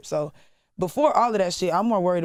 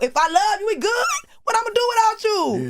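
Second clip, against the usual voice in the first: much higher and louder, hammed-up devotion; dramatic lover girl; 5 dB louder than usual. Playing up her lover girl side to the host, high and hammy. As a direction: swoon over him like a lover girl. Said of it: "I'm a lover girl".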